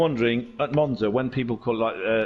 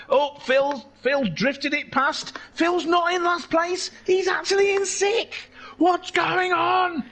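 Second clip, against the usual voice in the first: higher, lively play-by-play call; excited race commentary; slower than usual. Acted. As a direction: call the race action as it happens.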